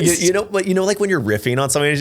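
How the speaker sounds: usual speaking voice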